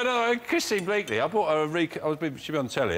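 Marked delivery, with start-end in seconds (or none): 0.0-1.2 higher, lightly voiced correction; fib-teller fixing the name; mock correction. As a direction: correct yourself brightly, a bit higher. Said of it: "I go".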